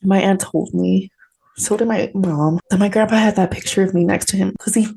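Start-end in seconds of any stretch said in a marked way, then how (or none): none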